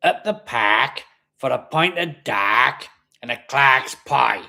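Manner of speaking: drawn-out Barry accent with a growl; slow, growly Barry dialect demo. Shown as a demonstration. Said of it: "can do a Barry accent".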